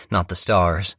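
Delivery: ordinary delivery, nothing marked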